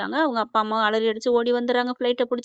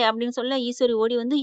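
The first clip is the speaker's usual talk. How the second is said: plain reading voice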